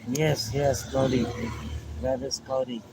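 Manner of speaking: ordinary delivery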